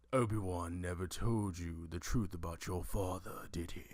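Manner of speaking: soft, low, breathy menacing whisper; whispered Darth Vader line. Retold or imitated. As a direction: hiss it like a villain's secret.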